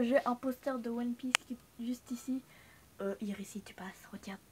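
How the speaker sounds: soft voiceless whisper. Real, not acted. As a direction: whisper so nobody hears. Whispering about a sleeping spot. (chuchoté, sans voix)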